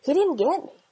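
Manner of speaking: earnest retold protest, pitch lifting at the end; very serious. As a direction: imitate a son seriously correcting mom. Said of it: "he's very serious".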